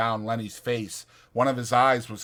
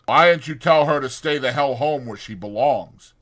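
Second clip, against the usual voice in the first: scornful Carlson; 3 semitones higher, 7 dB louder than usual. Acted. Carlson scolds Curly.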